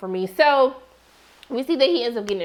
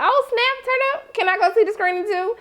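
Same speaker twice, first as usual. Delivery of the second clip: much higher, hyped-up squeal; excited; 9 semitones higher than usual. Retold or imitated. Excited viewer reacting to the movie announcement, squealing high. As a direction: react like a kid told about a movie. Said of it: "And I was like".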